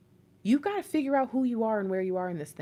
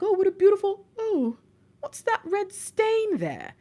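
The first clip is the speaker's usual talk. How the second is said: mock surprise; 10 semitones higher than usual. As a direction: sound amazed, then spot something wrong. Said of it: "it's like".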